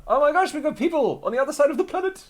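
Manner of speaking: very high, fast exclamation; amazed excitement. Real, not acted. Streamer learning of a viewer in Argentina, amazed. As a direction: blurt it out high and quick.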